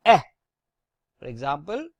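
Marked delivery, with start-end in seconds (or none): 0.1-0.2 eh as an open French è; grave E sound. Shown as a demonstration. Teacher showing the sound of E with a grave accent, open eh. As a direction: say eh with an open e, like in pear. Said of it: "becomes pronounced as".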